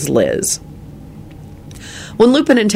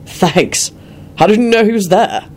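Quentin, angry gruff voice; 6 semitones higher than usual. A narrator's reading. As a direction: bark at him, fed up.

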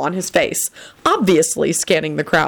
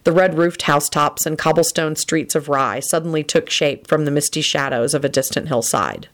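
ordinary delivery

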